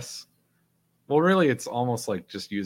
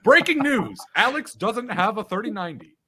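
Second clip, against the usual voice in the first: high, loud news anchor announcing; newscaster voice; 10 semitones higher, 4 dB louder than usual. Pretend. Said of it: "That's a beautiful transatlantic accent you got there".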